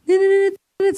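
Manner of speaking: high quick phone beeps; imitated phone ring. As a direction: mimic a ringing phone. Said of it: "telepon saya bunyi dong".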